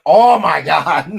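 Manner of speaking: loud, slow dismayed exclamation; dad overwhelmed by the scream, loud and slow; exasperated oh my God. Retold or imitated. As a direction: groan it out, overwhelmed.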